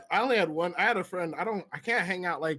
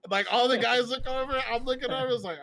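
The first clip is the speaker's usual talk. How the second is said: ordinary delivery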